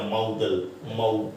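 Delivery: low, careful ਮਾਡਲ, then just ਮਾਊ; correct pronunciation demo. Shown as a demonstration. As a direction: model ਮਾਡਲ as MOW-dul, isolating the 'au' glide. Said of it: "ਇਸ ਨੂੰ ਆਪਾਂ ਨਾ ਮਾਡਲ ਬੋਲਦੇ ਹਾਂ". (ਮਾਡਲ ਨੂੰ 'ਮਾਊ-ਡਲ' ਵਾਂਗ ਨੀਵੀਂ ਸੁਰ ਵਿੱਚ ਬੋਲੋ)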